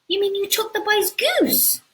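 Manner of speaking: high, indignant disbelief; shocked mum. Acted. Mum interrupting Jack's story, high and shocked. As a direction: cut in scandalised. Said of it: "Jack's mama interrupted".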